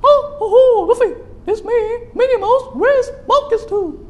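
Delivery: very high, loud squeaky cartoon mouse; shrill falsetto cartoon girl mouse; Minnie Mouse voice. Shown as a demonstration. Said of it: "Or Mickey Mouse voice".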